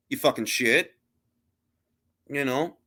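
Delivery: ordinary talk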